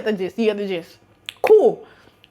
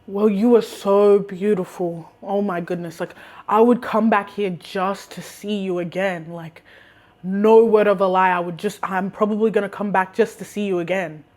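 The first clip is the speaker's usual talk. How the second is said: put-on smitten older customer; 3 semitones lower than usual. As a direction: reenact an intense, earnest come-on. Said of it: "Let me reenact this".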